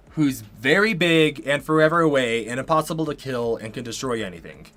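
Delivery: ordinary talk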